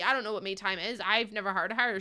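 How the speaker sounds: ordinary delivery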